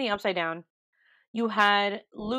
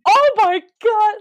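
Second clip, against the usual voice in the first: high, loud oh my god; screaming at the screen; shocked scream; 11 semitones higher, 12 dB louder than usual. Retold or imitated. Crying fan reliving the episode, screaming in shock.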